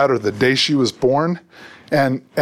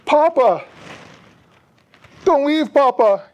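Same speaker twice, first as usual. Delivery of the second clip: put-on little girl crying out; 12 semitones higher, slower than usual. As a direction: cry out like a small girl begging him to stay. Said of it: "cries out".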